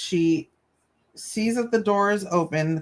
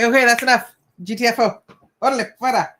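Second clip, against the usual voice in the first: mock shooing; 5 semitones higher, 4 dB louder, much faster than usual. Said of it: "And I was like".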